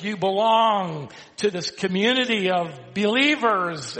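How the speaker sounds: emphatic pulpit cadence, slow on key words; fervent, drawn-out sermon climax; building preaching. Real, not acted. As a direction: build the truths into a proclamation.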